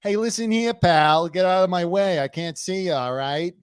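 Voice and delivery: high, pushy Long Island tough guy; hammed-up Long Island wise guy; Long Island accent. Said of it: "What are you, Long Island now?".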